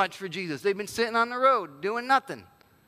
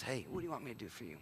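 much softer and fast, tender invitation; gentle question; 7 semitones lower, 15 dB quieter, much faster than usual. Retold or imitated. Jesus stopping to ask the blind beggars, soft and tender. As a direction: ask them kindly, close and quiet.

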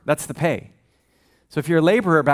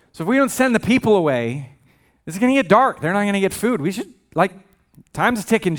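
anxious urging; 4 semitones higher than usual. Retold or imitated. Disciples nervously urging Jesus that time's ticking, higher and rushed. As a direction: hurry him along, worried.

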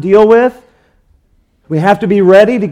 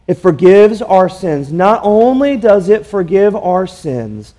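ordinary talk, nothing marked

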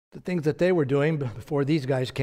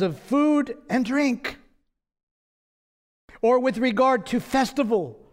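raised, slow scripture reading; 7 semitones higher than usual. Acted. Pastor reading Colossians to the church, raised and slow. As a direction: lift the verse up to the congregation. Preacher reads Colossians aloud.

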